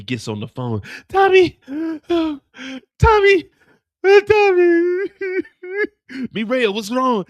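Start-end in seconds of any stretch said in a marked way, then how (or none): 1.1-5.1 very high, loud falsetto wail; distressed woman. Acted. Mireya on phone, distressed. 6.3-7.2 higher, louder alarmed question. Acted. Tommy alarmed on phone.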